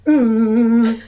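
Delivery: loud silly mm sound; silly hum. Shown as a demonstration. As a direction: hum it loudly like a game. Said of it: "I'll be silly and go".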